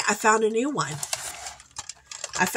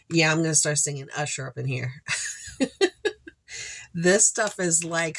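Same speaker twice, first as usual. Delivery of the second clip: normal talking voice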